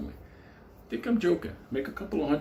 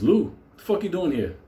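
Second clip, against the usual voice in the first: surprised cop; 7 semitones higher, 4 dB louder than usual. Retold or imitated.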